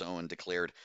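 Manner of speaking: ordinary delivery, nothing marked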